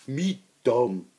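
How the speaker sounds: lightly voiced dim-witted orc; dumb orc voice. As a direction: play it simple and proud of it. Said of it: "I don't know".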